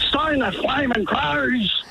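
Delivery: put-on soap character's outburst; Alf from Home and Away. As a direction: stretch it out as an old catchphrase. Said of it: "Alf from Home and Away was so fond of saying".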